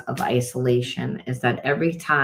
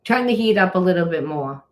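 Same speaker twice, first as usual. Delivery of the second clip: high, loud booming call to the universe; loud, lifted command: turn the heat up; 7 semitones higher, 5 dB louder than usual. Retold or imitated. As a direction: proclaim it up high like a deity giving orders. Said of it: "it says".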